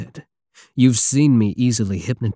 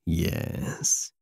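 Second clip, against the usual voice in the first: whisper; 8 dB quieter than usual. Acted. Whispered aside.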